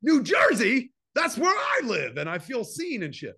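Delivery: overjoyed at hearing his home state; mock excited. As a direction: moviegoer thrilled to hear New Jersey named in a movie.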